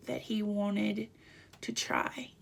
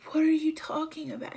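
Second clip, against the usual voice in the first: higher, louder, quick retort in retelling; upset and mad; 7 semitones higher, 5 dB louder, faster than usual. Retold or imitated. Husband confronting his wife after she blames him, upset and mad. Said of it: "He's crying, he's mad".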